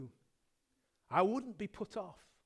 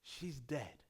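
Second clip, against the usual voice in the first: ordinary talk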